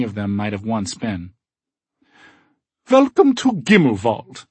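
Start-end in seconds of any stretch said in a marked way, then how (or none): 2.9-4.5 put-on high, slow, friendly German accent; cheerful German accent. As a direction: welcome guests warmly, German accent. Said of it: "says cheerfully with a slight German accent".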